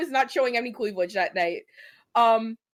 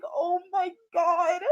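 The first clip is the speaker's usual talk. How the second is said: exasperated groan; 9 semitones higher, much slower than usual. Retold or imitated. Walking inside after the date.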